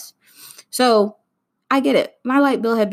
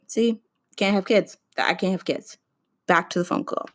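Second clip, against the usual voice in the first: normal talking voice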